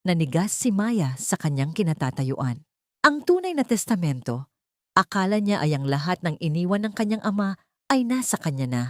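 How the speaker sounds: plain reading voice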